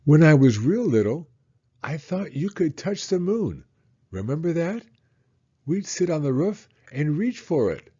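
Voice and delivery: put-on a small boy remembering, full of wonder; child's voice, bright with memory; eager little boy. Child voice reading son's lines.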